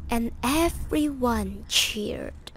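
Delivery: ordinary delivery, nothing marked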